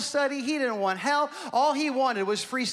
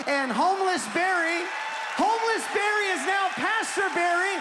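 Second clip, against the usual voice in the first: higher, shouted sermon climax with slow stretched phrases; preaching at full force; 6 semitones higher than usual. Real, not acted. Pastor shouting the climax of Barry's story to the church. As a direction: lift the house with it.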